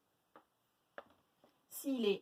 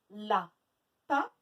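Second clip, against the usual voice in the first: LAPIN as la, pause, pin; split into syllables; 2 semitones lower, 3 dB louder than usual. Shown as a demonstration. Teacher counting syllables for pupils, syllable by syllable. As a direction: break Lapin into two beats. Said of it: "deux syllabes".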